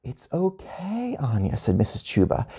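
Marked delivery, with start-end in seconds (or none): 0.0-1.6 soft, slow, lilting comfort; hushed, high teacher reassurance; soothing. A narrator's reading. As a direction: soothe an upset child very softly. Teacher soothes upset Anya.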